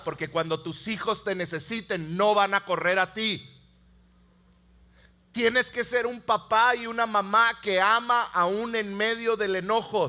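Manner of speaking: ordinary delivery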